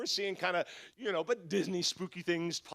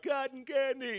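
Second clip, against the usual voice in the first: high, small mock-tearful child voice; crying kids imitated; 5 semitones higher, slower than usual. Little kids asking dad for a treat, imitated tearful voice. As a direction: whimper it like a little kid, hammed up. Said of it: "and they're crying".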